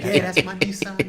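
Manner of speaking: high, loud, swaggering mock rapper boast; cocky confidence. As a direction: brag it like a star who knows it's a hit. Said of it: "Like, he was so confident".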